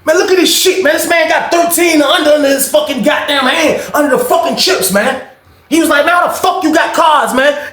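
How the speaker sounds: expose the cheat at full volume; angry shout. Retold or imitated. Cheated player shows the hidden cards, yelling high.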